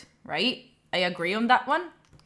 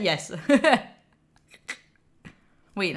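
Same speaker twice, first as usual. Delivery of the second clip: ordinary talk, nothing marked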